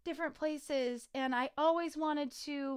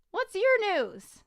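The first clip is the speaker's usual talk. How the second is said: eager question; 9 semitones higher, 8 dB louder, slower than usual. Real, not acted. Asks friend excitedly.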